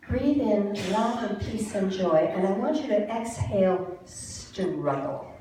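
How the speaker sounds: calm breathing instructions; guided breathing. Acted. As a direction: talk them gently through each breath. Leads a breathing exercise.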